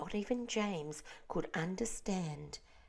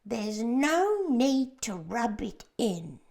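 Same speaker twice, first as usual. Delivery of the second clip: loud, slow irritated retort; earthworm snapping; 5 semitones higher, 8 dB louder, slower than usual. A narrator's reading. The earthworm snaps at James over his blindness. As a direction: bite back hurt and cross. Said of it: "snapped the earthworm".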